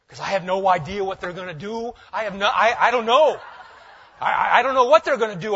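loud, high flustered stammering; mock panic. Acts out dread of bringing a friend.

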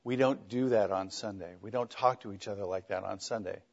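low, hushed parent's reproof; exact parent voice. Retold or imitated. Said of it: "the exact voice I'd go".

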